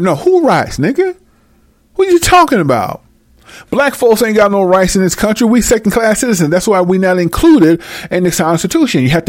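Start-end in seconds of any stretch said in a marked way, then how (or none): 0.0-5.1 high, sharp disbelief; high incredulous retort. Real, not acted. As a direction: scoff at the idea in disbelief.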